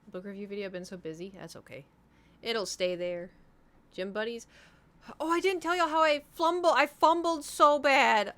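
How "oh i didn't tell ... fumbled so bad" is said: loud, high wail of embarrassment; loud lament. Real, not acted. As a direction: blurt it out loudly, dragging the end. Bursts into a confession of embarrassment.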